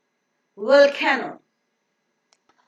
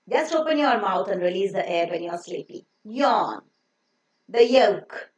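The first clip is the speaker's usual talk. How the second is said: ordinary delivery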